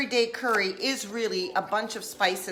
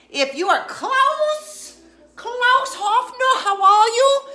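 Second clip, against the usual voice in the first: high, loud, hammed-up greeting voice; mock-grand hello to a viewer; put-on voice; 12 semitones higher, 9 dB louder, slower than usual. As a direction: ham it up with a silly high voice. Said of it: "I don't know why I'm talking like that".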